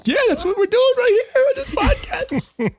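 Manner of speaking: very high, hammed-up weepy squeal; mock tearful gushing. Host relating the story to the podcast, mock tearful squeal. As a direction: blubber it with laughter breaking in. Acts out own gushing reaction.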